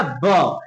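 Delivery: ब as a lone, low, falling "buh" sound; phonics letter sound. Shown as a demonstration. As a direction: give the sound of ब, not the letter name. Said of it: "बी का sound actually देखा जाए".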